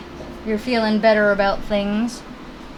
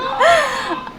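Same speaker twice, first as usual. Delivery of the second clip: startled laugh. Real, not acted. Laughing after scare.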